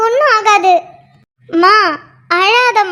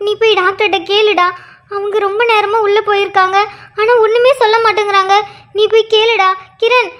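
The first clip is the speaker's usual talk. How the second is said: ordinary talk, nothing marked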